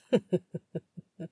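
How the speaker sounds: mocking chuckle from the rival; short derisive laugh; scornful laugh. A narrator's reading. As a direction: laugh off his claim. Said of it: "said in that low drawl".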